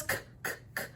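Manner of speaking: k, k, k as crisp repeated k sounds; letter sound demo. Shown as a demonstration. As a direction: tap out k, k, k for the letter C. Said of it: "The C goes".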